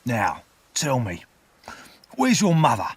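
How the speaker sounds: rough convict questioning, rising to loud; sudden demand. A narrator's reading. Convict interrogates the boy on the gravestone, sudden demand. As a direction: start measured, then fire the question. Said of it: "asked suddenly".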